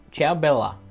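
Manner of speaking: usual speaking voice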